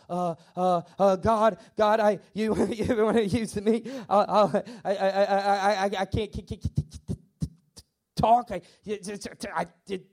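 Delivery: stuttering, stuck on the first words; stammering Moses. Retold or imitated. Moses making excuses to God at the burning bush, stammering. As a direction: stammer haltingly, words refusing to come.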